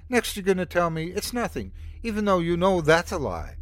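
snapping. Acted. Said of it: "she snapped".

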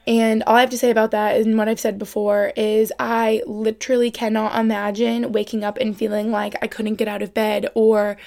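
normal talking voice

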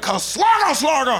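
mock-German shout at the dog; shouted gibberish German command. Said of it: "gave the dog a command in German".

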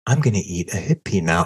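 soft, low, hushed ASMR murmur; quiet ASMR demo for the fans. Shown as a demonstration. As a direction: lean into the mic and murmur it softly. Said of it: "in, in the A S M R voice".

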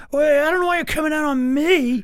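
high, mock-indignant imitation of a colleague; put-on high voice, hammed-up defensiveness; passive-aggressive Brady. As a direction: pitch way up, protesting like a wounded co-host. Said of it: "there's a passive-aggressive moment".

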